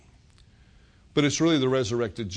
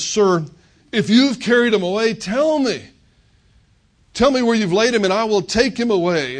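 much higher and louder, begging; desperate plea; 7 semitones higher, 7 dB louder than usual. Acted. Weeping Mary begs the supposed gardener for the body, desperate and loud. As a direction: plead with the gardener to tell you where.

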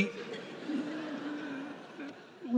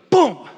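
punch sound effect; 4 semitones higher, 7 dB louder than usual. Retold or imitated. Brad throws a sucker punch.

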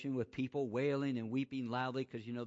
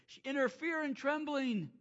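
rising, louder preacher's build; impassioned preaching, higher and louder; preaching at full force; 11 semitones higher, 5 dB louder than usual. Acted. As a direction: climb in pitch and volume, preaching.

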